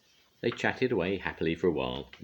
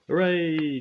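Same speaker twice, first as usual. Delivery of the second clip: loud, high hooray; a crowd celebrating a hero; cheering; 12 semitones higher, 8 dB louder than usual. A narrator's reading. Crowd cheering for Mister Brave, loud and high. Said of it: "cheered the crowd".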